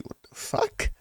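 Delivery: breathless whispered disbelief; whispered aside. Retold or imitated. A guy whispering his shock at her hesitant yes. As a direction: hiss it under your breath in shock. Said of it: "I'm like".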